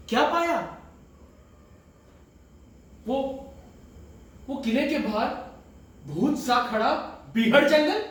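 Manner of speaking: high, intense, rising phrases; agitated questioning. Acted. Sultan asks what he found here, agitated. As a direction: pitch each image up like an unanswered question.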